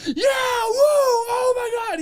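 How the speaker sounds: high, slow whooping celebration; ecstatic cheer. Retold or imitated. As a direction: scream with joy running off stage. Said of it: "he just goes".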